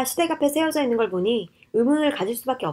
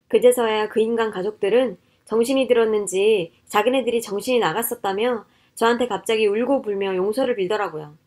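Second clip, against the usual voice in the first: usual speaking voice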